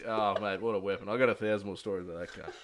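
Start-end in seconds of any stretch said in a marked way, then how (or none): none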